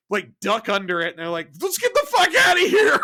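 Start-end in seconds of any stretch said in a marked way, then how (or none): 1.6-3.0 high loud frantic yell; panicked shout. Retold or imitated. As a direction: yell it while fleeing in terror. Crew fleeing the Borg cube.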